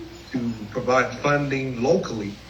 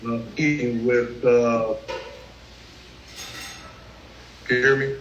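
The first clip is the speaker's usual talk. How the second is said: fuzz distortion. Real, not acted. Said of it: "you sound a little distorted".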